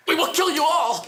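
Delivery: very high, frenzied threat; screamed threat. Acted. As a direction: shriek it like a comic zealot. Activist hurls a death threat.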